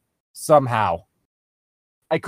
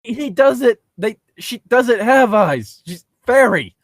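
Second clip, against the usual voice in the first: higher, louder mock objection; amused protest; 7 semitones higher, 4 dB louder, slower than usual. Real, not acted. Player objecting to the narrator about fairy eyes, amused. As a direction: call out the story's mistake, amused.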